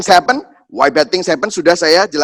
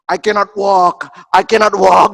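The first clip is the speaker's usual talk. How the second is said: slow, drawn-out dramatic complaint; milk it like a hammy toddler; child's melodrama; 5 dB louder, slower than usual. Retold or imitated. Toddler after the shots, overly dramatic complaint. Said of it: "dia drama banget".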